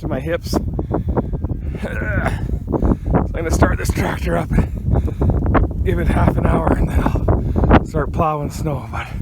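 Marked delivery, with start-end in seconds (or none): 0.6-3.1 heavy sigh. Real, not acted. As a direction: exhale hard in tiredness. Tired after wading through deep snow.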